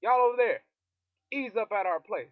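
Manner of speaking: loud, high yell at the British; rebels yelling. Retold or imitated. Chinese rebels shouting at the British, loud. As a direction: holler them off your land. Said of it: "they're like".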